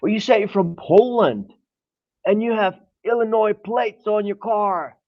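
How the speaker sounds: imitating the angry dad.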